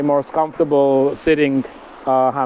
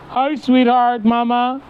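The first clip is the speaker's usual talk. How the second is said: sweet pet talk; 7 semitones higher, slower than usual. Real, not acted. Calls the cat.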